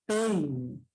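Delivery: "Peine" falling away after a drawn-out pei; stress on the E. Shown as a demonstration. Teacher showing the decreasing diphthong, stress on the E. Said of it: "con mayor fuerza de voz en la E".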